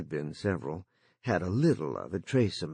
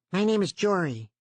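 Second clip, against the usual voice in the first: put-on eager, higher boyish voice; young boy voice; 10 semitones higher, 3 dB louder than usual. A narrator's reading. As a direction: play the lonely boy, keen to chat. Jory speaks through the line.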